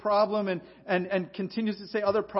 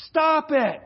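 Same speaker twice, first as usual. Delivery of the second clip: loud, higher, blunt command; commanding shout; 10 semitones higher, 11 dB louder than usual. Acted. Pastor giving the church its first command, loud blunt shout. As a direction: bark the command at the flock. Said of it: "the first command is".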